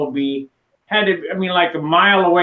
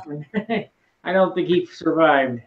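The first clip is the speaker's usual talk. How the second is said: ordinary talk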